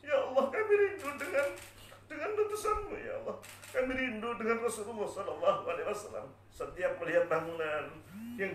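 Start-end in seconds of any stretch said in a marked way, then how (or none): 0.0-6.3 very high, sobbing cry of longing; crying harder. Acted. Bilal nearing Madinah, crying out in longing. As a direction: wail it to God, acted and tearful. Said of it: "tambah nangis lagi".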